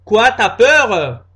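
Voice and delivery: loud, slow taunt; taunting girlfriend. Acted. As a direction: dare him.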